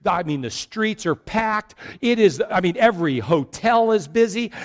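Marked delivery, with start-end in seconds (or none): none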